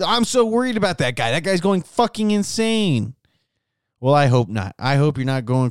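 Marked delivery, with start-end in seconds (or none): 0.1-3.1 imitated worried listener. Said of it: "You'd be like".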